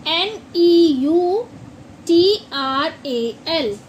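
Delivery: normal talking voice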